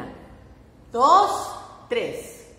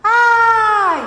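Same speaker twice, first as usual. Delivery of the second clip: loud, high mock squeal of delight; playful squeal; 10 semitones higher, 14 dB louder than usual. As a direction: squeal as if splashed by cold drops. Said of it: "me hacen gritar".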